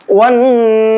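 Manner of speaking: slow, drawn-out melodic tilawat; Quran recitation. Shown as a demonstration. Teacher opens the lesson reciting slowly for students. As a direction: chant each word slowly, stretching the vowels. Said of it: "پر کر کے پڑھیں گے".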